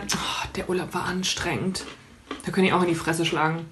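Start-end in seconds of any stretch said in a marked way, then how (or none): 0.0-1.8 whiny complaint. Retold or imitated. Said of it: "sie sagen dann".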